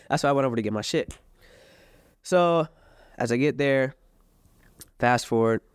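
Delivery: normal talking voice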